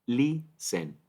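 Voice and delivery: teacher's syllable-by-syllable "listen", LIS stressed; stress on first syllable. Shown as a demonstration. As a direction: punch the first syllable of "listen" and separate the second. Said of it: "sílaba tónica en listen es la primera".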